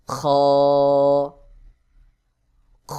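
খ from the end of the throat, long drawn out; throaty letter demo. Shown as a demonstration. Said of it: "গলার শেষ অংশ হইতে". (গলার শেষ অংশ থেকে টেনে খ উচ্চারণ)

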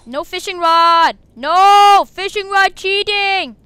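loud, slow, drawn-out complaint; shouted protest. Real, not acted. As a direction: cry foul at the top of your voice. Protesting a cheat in game.

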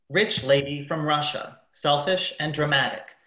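ordinary delivery

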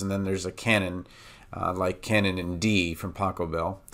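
normal talking voice

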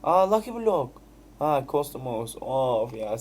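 ordinary delivery

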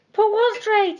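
high, loud bewildered cry. A narrator's reading. Jeremy cries out at the clock, high and loud. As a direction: cry out in confused alarm. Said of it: "he cried".

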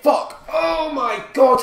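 high, loud cry of disbelief; frustrated shout. Real, not acted. Gamer reacting to a conceded goal, frustrated shout. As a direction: yell it out, stretching the words.

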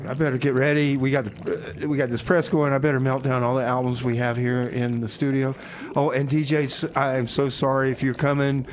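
ordinary talk

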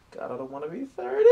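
high-pitched playful lament; hammed-up dread of getting older; mock despair. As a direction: wail it jokingly, pitch climbing. Mock despair about his age.

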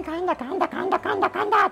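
high-pitched, repeated mock cries; mock panicked outcry. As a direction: act out making a big fuss over a bite. 言ってはいけない騒ぎ方の実演. (大げさに騒ぐ真似)

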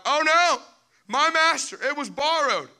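high, loud panicked wail; panicked cry. Acted. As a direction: cry out in panic over the lost axe. Servant panics over lost axe.

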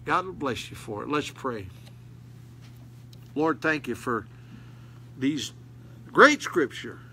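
usual speaking voice

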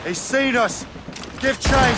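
high, loud call to the crew; urgent shout. Acted. Captain to crew as the quarry flees, loud urgent shout. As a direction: shout the chase order over the wind. Captain spots fleeing ship, orders chase.

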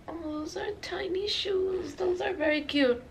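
high-pitched cooing over something tiny; gushing coo. Acted. Mom-to-be showing off handmade baby shoes, high gushing coo.

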